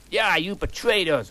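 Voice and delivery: half-hammed betrayal cry; mild angry shout. Vampire accusing Draco of betrayal, mildly angry. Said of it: "shooted Vampire angrily".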